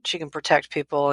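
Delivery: ordinary talk, nothing marked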